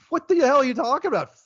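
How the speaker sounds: high, fast burst of disbelief; incredulous outburst. Acted. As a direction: blurt it out high-pitched, as if you cannot believe it. Incredulous reaction to the Obama quote.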